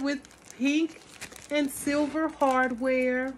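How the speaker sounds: ordinary delivery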